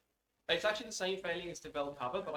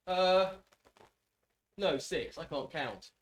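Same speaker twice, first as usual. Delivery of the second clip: ordinary talk, nothing marked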